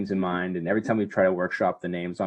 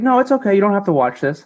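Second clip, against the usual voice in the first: mock wife voice; 9 semitones higher, 9 dB louder, faster than usual. Mimicking wife brushing him off.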